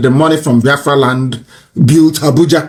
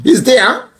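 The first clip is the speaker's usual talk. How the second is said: usual speaking voice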